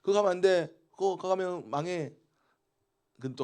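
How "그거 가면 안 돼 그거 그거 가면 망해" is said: loud, high warning from God; a stern mock warning, slowing down; God calling his people back, half-acted. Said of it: "딴 길 갑니다".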